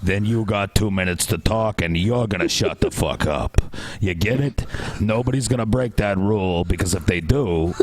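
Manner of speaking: low mobster laying down rules; mafia voice. Acted. Moderating two debaters as a mafia boss, low menacing voice. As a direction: lay down the law like a mafia boss, pitched low. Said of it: "you gotta use your mafia voice".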